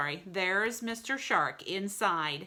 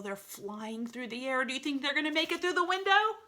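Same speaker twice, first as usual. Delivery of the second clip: usual speaking voice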